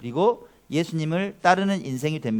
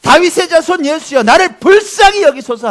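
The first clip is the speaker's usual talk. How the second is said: high, loud cry for mercy; loud, pleading shout; Bartimaeus's yelling; 10 semitones higher, 10 dB louder than usual. Acted. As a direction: yell it out with all your might. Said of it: "소리를 막 바락바락 지른 거예요".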